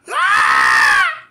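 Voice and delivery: high loud shriek of fright; terrified scream. Retold or imitated. Boy spotting a snake in church, terrified scream.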